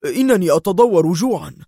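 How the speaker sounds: hungry patient crying out suddenly. A narrator's reading. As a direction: burst out with it without warning. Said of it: "ثم صاح بغتة".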